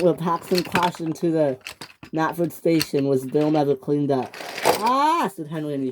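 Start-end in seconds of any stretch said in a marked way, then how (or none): 4.6-5.3 high "ah" scream; crash scream. Acted. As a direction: cry out as you crash.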